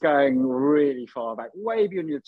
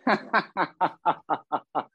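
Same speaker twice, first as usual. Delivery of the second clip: amused chuckling laugh; drawn-out run of chuckles; 2 semitones higher than usual. Real, not acted. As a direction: chuckle steadily at the silly title. Amused by joke title.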